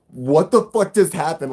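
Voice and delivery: loud, high, drawn-out disbelief; stunned disbelief. Retold or imitated. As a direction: re-enact the shock after a near crash. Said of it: "we could not believe it".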